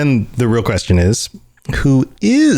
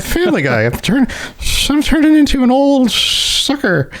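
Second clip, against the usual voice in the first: put-on much higher old man voice; old prospector. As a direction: squeak it like an old geezer. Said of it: "I'm like".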